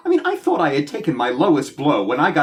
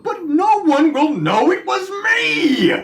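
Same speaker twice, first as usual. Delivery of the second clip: anguished outcry; 12 semitones higher, slower than usual. Acted. Bowden outraged about lost credit.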